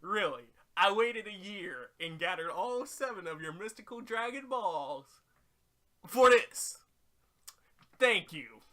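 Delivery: loud, rising exasperated rant; sarcastic outrage. Pretend. Oolong ranting at the useless dragon, loud sarcastic outrage. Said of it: "You're just like".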